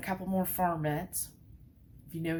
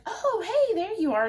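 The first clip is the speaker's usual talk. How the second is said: high, chirpy dog voice; voicing the dog; 12 semitones higher than usual. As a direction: play the happy dog finding its owner. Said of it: "she's like".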